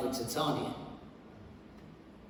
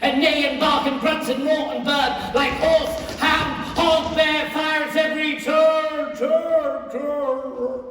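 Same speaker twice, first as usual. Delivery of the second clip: louder, higher, gleeful yelling; mischievous shout; 6 semitones higher, 3 dB louder than usual. Acted. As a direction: crow it out like a wild sprite. Puck gleefully chases actors.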